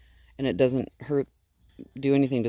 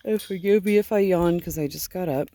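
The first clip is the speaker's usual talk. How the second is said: normal talking voice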